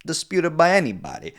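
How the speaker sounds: quoting the mobster in a high mock voice; Paulie voice. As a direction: pitch way up to imitate him deadpan. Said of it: "he's like".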